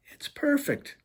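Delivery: delighted. Acted. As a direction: marvel at the painting. Delighted at gift.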